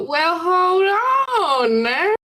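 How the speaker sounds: high, long-drawn Southern drawl; Southern accent. Acted. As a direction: stretch it out in a put-on Southern voice. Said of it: "I put on a southern voice".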